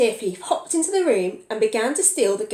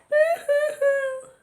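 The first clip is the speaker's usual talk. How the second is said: high, slow boo-hoo wail; child crying; slower than usual. Acted. Storyteller voicing the crying child again. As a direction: cry it out like a small child. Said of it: "The crying was coming from".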